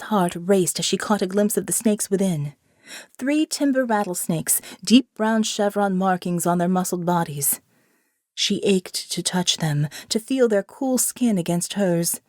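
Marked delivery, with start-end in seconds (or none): none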